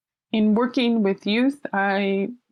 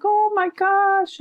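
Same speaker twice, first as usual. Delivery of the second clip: much higher, slow "oh my gosh"; surprised; 11 semitones higher, slower than usual. Retold or imitated. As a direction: stretch it out in amazed recognition. Her reaction to seeing him.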